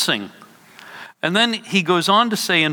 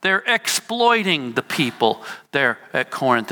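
normal talking voice

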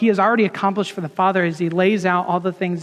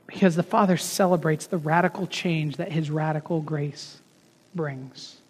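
softer, lower, letting it sink in; quiet repeat; 4 semitones lower, 6 dB quieter than usual. Shown as a demonstration. Preacher repeating his key line to the congregation, hushed and reflective. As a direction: drop the voice and linger on each word.

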